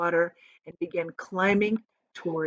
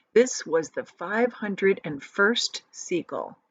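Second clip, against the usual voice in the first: ordinary delivery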